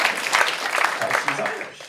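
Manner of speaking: "She's Irish" said slowly through laughter; a slow, laughing punchline. Real, not acted. Proud father tells the audience, laughing.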